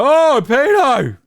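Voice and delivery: high, slow, loud jeering cry; mocking dumb yell. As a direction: mimic an internet idiot's jeer. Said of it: "were like".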